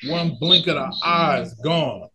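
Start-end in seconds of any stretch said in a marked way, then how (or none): none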